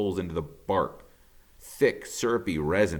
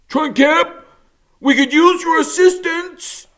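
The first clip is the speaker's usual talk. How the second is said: very high, loud holler up to the trees; hollering beaver; 12 dB louder than usual. Acted. Mister Beaver hollering up to the woodpecker in the canopy. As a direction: call out to a bird far above.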